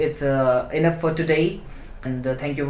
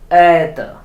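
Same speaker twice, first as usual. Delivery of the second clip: "ether" with a long, loud first vowel; stressed first vowel; 8 dB louder than usual. Shown as a demonstration. Teacher demonstrating a stressed vowel to learners, emphatic stress. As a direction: lean hard on the start of "ether", weak ending. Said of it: "with a longer pronunciation".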